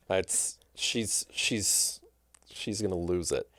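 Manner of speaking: soft, breathy near-whisper through a laugh; quietly overwhelmed, half laughing; hushed. Real, not acted. Moved by a gift, hushed.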